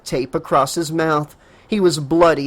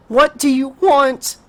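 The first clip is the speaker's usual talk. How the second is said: begging through tears; 10 semitones higher, 4 dB louder than usual. Acted. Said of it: "Casey begged through a torrent of tears".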